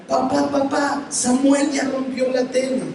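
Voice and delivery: niña que corre a acusar, aguda; hermanita acusando. Retold or imitated. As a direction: imita a la hermanita chismosa, más aguda. (niña acusona llamando a papá)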